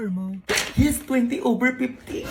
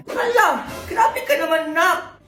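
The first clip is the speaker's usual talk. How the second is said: delighted gasp; 7 semitones higher, slower than usual. Acted. Mother thrilled by son's gifts.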